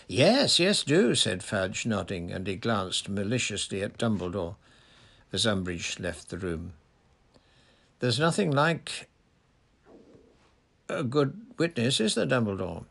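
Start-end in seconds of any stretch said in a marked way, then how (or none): 0.1-1.1 high, loud eager minister; eager Fudge. A narrator's reading. Fudge eagerly agreeing aloud. 8.0-12.8 higher, smug little jab; a hint of a gloating minister; gloating Fudge. A narrator's reading.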